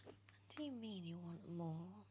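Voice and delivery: plain reading voice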